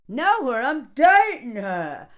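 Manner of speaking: mock emphatic. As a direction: press it as a claim. Boastful character.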